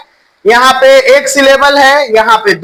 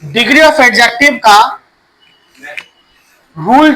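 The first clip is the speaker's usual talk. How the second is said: slow dictation; much slower than usual. Shown as a demonstration. Teacher dictates heading to class.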